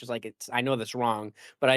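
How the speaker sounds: ordinary talk, nothing marked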